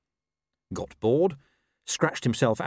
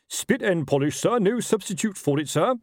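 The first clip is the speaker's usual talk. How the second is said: high, barked parade-ground report; nervous military report; 10 semitones higher, 4 dB louder than usual. Acted. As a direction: report stiffly at attention, voice pitched up. Nervous officer reporting to ruler.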